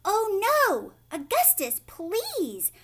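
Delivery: high, loud alarmed cry. A narrator's reading. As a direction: plead as Wonka panics. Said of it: "he cried out".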